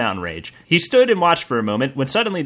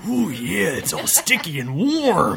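macho bandit; 3 semitones higher than usual. Acted.

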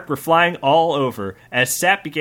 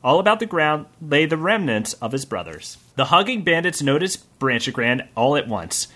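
usual speaking voice

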